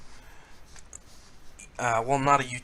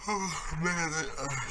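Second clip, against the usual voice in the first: talking through a yawn; words stretched by a big yawn; 6 semitones higher than usual. Real, not acted. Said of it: "excuse the yawning".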